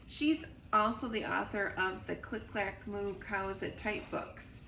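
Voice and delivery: ordinary delivery, nothing marked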